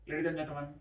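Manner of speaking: droning lecturer voice, half laughing; boring monotone. Retold or imitated. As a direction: imitate a dull scientist reading a graph. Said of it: "in a monotone voice".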